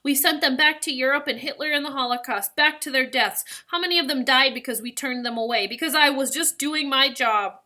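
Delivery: distraught grandfather's self-accusation. A narrator's reading. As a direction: demand it of yourself in anguish.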